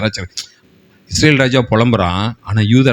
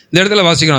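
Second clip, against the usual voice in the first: ordinary talk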